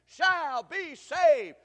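loud, high, very slow chant; call and response. Shown as a demonstration. Preacher leading the congregation's reply, loud and slow. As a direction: lead the crowd to answer back. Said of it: "Say that with me".

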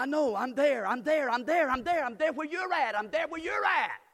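loud, high preaching at full force; fiery preaching. Real, not acted. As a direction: preach it out to the whole church.

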